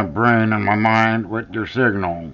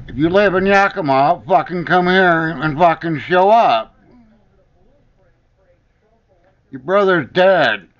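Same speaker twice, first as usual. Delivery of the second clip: furious rant; 6 semitones higher than usual. Real, not acted. Yelling at voices he hears.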